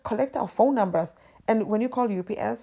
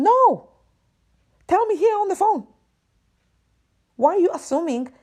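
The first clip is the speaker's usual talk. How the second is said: much higher, loud angry yell; furious shout; 10 semitones higher, 6 dB louder than usual. Real, not acted. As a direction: scream your demand down the line. Ranting about being sent online.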